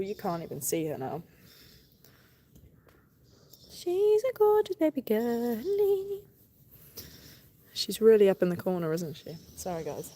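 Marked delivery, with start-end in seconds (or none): 3.7-6.2 much higher, slow cooing voice. Real, not acted. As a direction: dote on the cow, sing-song. Baby talk to the cow.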